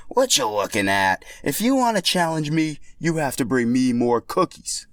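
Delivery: lazy tough-guy brush-off; Boshi voice. Acted. Boshi brushing off the challengers, smug voice.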